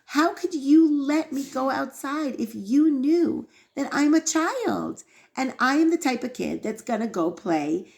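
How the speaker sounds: high, indignant protest to a father; imitating an outraged kid; retold child's yelling. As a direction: blame your father, pitch rising. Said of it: "yells at his father".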